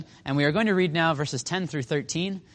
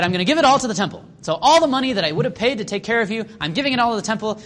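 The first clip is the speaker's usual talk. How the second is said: fast, higher, louder hammed-up bragging; mock eager boast; 6 semitones higher, 4 dB louder, faster than usual. Son dumping parents for the temple, fast mock boast. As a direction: rattle it off like a show-off.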